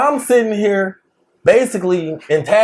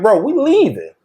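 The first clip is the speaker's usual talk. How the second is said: exasperated retort; 8 semitones higher than usual. Retold or imitated. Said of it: "We like".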